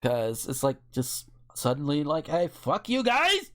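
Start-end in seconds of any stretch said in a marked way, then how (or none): 2.3-3.5 high, slow mock yell at everyone; mock shooter outburst. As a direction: play the angry shooter, pitched way up.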